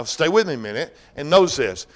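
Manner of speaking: ordinary talk, nothing marked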